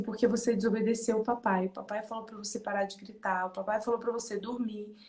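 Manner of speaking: quiet explaining. Retold or imitated. Mother telling son why no story, quietly. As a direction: explain softly and evenly to a child.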